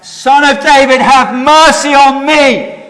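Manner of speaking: high, loud, slow plea over the crowd; shouting back. Retold or imitated. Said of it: "the more Bartimaeus shouted back".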